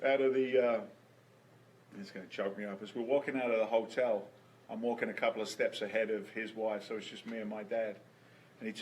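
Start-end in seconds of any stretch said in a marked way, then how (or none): none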